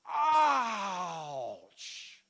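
loud pained ouch; pained cry. Retold or imitated. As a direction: cry out as if it hurts. Feeling God's pain.

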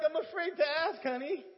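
loud, high comic pleading; hammed-up dread, shouted to a wife; mock dread. Repeats the acted dread to wife.

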